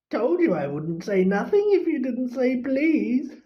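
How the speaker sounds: high, gleeful poltergeist with laughter; mock them with a high cackle; cackling poltergeist. A narrator's reading. Peeves voiced, laughing.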